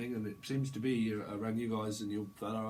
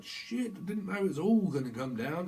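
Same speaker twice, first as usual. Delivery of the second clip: shocked; 6 semitones higher, 3 dB louder than usual. Acted. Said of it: "It's like".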